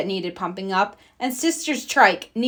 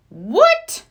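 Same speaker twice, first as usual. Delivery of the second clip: shocked; 7 dB louder than usual. Acted. Papa hears no TV.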